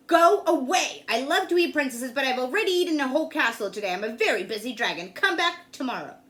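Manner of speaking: loud, grumpy dragon shooing her off; loud annoyed dragon voice. A narrator's reading. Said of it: "The dragon stuck his nose out of the door and said".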